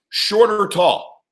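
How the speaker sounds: slow, drawn-out question; slow deliberate question. Retold or imitated. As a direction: ask it slowly, spacing each word. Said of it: "He goes".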